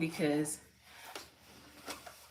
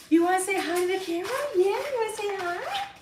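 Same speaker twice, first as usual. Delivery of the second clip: much higher, fast sing-song pet voice; 13 semitones higher, 5 dB louder, faster than usual. Real, not acted. Talking baby talk to her dog.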